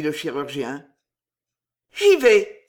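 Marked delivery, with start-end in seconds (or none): 1.9-2.7 sudden loud, higher cry; exclaiming. A narrator's reading. Thérèse resolving to go to Michel, exclaiming. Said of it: "s'écria Thérèse".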